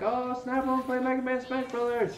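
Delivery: high, loud excited squeal; excited gush. Retold or imitated. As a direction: burst out like an excited kid. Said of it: "I was like".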